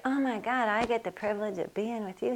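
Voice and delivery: cooing. Retold or imitated. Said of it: "She's like".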